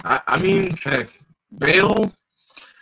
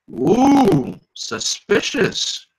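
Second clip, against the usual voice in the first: high mock-suspicious ooh, drawn out; playful suspicious gasp; 10 semitones higher, 6 dB louder, much slower than usual. Real, not acted. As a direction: tease the twist with a slow high ooh. Reacting to plot twist.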